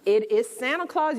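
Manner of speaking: usual speaking voice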